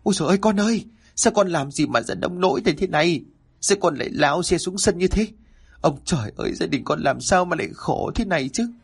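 mother wailing at her fallen son, panicked; panicked cry. A narrator's reading. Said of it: "bà vừa chạy bà vừa la lên thất thanh".

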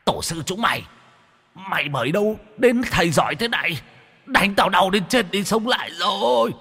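very high, wailing ghost pleading in pain. Acted. Whipped ghost begs the master, very high wailing. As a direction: cry out like a beaten spirit.

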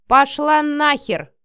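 slow, loud, high dismissal; every word hammered out. A narrator's reading. Man dismisses the woman threatening him, each word hammered out. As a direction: punch out each word separately. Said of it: "отчеканил каждое слово Матвей".

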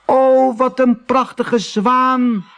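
high, loud, then slow and marvelling; children crying out in wonder together; amazed cry. A narrator's reading. Farm children crying out at the swan, high amazed and slow. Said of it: "ze riepen allemaal tegelijk".

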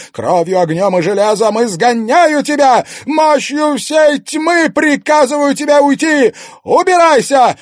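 very high, loud banishing cry; commanding shout. Acted. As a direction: drive the spirit out, commanding. Priestess exorcising the spirit.

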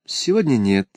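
slightly drifting reply; absent-minded. A narrator's reading. Miss Amy answering Missouri about the service, mildly absent-minded. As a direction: answer with your mind elsewhere. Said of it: "рассеянно ответила мисс Эйми".

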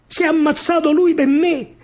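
ordinary delivery

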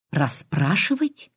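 slow question with steeply rising pitch; piercing incredulous query. A narrator's reading. Old woman challenging the detective, piercing disbelief. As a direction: draw it out, incredulous, voice climbing. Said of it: "пронзительно выкрикнула старуха".

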